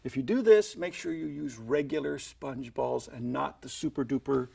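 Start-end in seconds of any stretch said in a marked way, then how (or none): none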